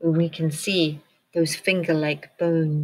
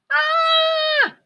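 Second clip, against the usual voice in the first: very high, loud bird shriek; 5 dB louder than usual. A narrator's reading. Flap falling in the dark, high loud shriek. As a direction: let out a terrified shriek. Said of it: "shrieked Flap".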